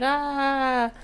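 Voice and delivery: quiet breathy mock groan; huffing like a hurt friend; soft mock groan. The coworker groans softly at being called corny. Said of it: "He like".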